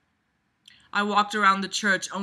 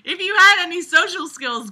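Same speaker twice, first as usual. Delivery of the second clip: mocking echo, pitched higher and louder; 10 semitones higher, 7 dB louder than usual. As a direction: throw the line back at the writer, mocking. Mockingly throws the line back.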